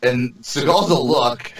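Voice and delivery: normal talking voice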